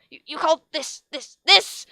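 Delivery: high, loud stammering outrage; appalled teacher stammers at the answer; appalled stutter. A narrator's reading. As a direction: stutter in disbelief. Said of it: "she stuttered, appalled".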